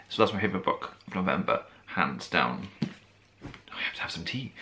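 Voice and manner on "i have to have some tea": hoarse, failing voice; losing her voice. Real, not acted. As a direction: speak through a tired, hoarse throat. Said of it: "I'm losing my voice".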